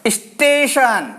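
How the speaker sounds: स्टेशन said slowly, with a leading इ sound; slow demo word. Shown as a demonstration. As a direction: spell out स्टेशन as इस्टेशन, slow. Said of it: "इसको अपन पढ़ते हैं स्टेशन". (धीमे से इस्टेशन बोलना)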